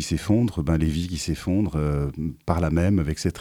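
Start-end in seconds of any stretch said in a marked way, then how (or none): none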